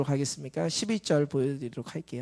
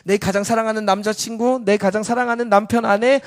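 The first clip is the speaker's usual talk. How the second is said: loud, raised sermon voice building in intensity; full-force preaching; 5 semitones higher, 7 dB louder than usual. Real, not acted. Preacher listing loved ones to give up, full-force preaching. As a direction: build the list with mounting force.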